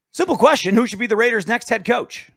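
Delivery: high, loud game-show reveal; big announcement. Acted. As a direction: announce it like a quiz host. Announcing question of the day.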